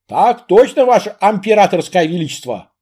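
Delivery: бодрый солдатский рапорт; громко, с жаром отчеканить; громкий бравый солдат. Acted. (громкий бравый солдат)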